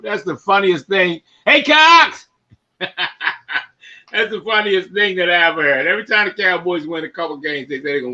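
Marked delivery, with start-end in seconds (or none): 1.5-2.3 calling out. Real, not acted. Calling out to friend.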